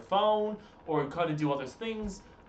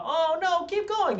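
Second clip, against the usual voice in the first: pleading protest; 13 semitones higher than usual. Acted. Streamer mimicking a wish to keep streaming, high mock pleading. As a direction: put on a high begging voice, as someone wanting more. Acting an eager plea to continue.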